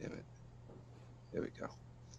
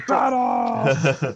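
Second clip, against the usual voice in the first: raised, drawn-out mock war cry; half-size comic battle yell; cried battle cry; 4 semitones higher, 4 dB louder than usual. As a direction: call it out like a warrior charging.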